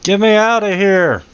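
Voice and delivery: high, desperate trapped shout; screaming for help. Acted. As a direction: cry out to be freed, pitched high. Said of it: "the guy in there screaming".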